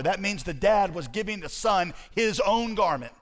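high, driving pulpit delivery; impassioned exposition to a congregation; forceful preaching. Acted. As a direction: preach it emphatically, pitch held up.

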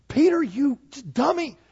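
high, loud mock scold; scolding Jesus. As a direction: snap at Peter in mock exasperation. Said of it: "Aren't you glad he wasn't like".

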